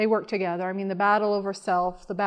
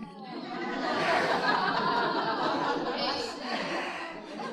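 long breathy laugh; 4 semitones higher, 6 dB quieter than usual. Real, not acted. Laughing over the stone heart gift.

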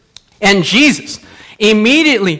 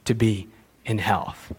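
gentle blessing; 10 semitones lower, 10 dB quieter than usual. Acted. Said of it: "and he said".

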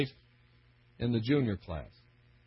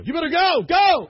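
high, loud hurry-up, building on the second go; urgent push; 13 semitones higher, 9 dB louder than usual. Retold or imitated. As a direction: shove him toward the stage with your voice. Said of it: "They go".

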